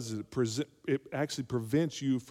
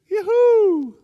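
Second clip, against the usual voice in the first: much higher, loud sing-song hail; comic call; 15 dB louder than usual. Pretend. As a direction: call across the house playfully. Calling out to get attention.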